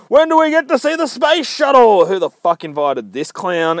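high, loud heckler's shout. Retold or imitated. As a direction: yell it out like an indignant heckler. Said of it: "a bit loud".

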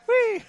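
high, loud gleeful whee; down a waterslide. Pretend. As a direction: squeal like riding a waterslide. Imagining goalie on waterslide.

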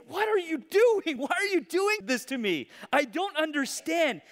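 high, loud pleading outrage; demand, then plead in confusion; exasperated king. Acted. King pleading with the prophet, high exasperated cry.

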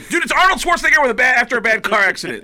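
loud, high indignant insistence; super defensive. Retold or imitated. Comic defending his impression to friends, loud mock defensive. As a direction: protest loudly at your critics. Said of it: "I'll get super defensive".

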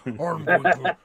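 a hint of a muffled Bane; lightly voiced masked villain; mock Bane rumble. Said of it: "Everybody sounded like Bane".

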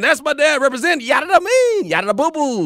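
hyphy slang voice. Retold or imitated. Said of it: "Had my son on Twitter saying".

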